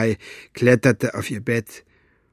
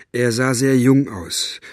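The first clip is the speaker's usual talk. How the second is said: usual speaking voice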